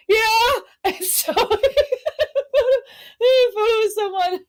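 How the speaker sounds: high, mock-sobbing agreement; imitating crying. Agreeing to a fan photo while bawling, mock crying. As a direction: wail it through pretend tears.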